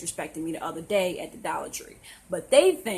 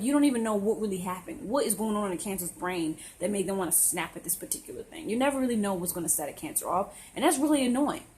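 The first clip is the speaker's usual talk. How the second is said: ordinary talk, nothing marked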